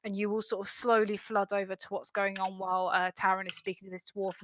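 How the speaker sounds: ordinary talk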